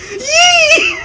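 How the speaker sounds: a very high, loud whoop that breaks into laughter; gleeful yelp. Retold or imitated. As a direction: whoop like a lunatic diving onto a bed. Him leaping onto the bed.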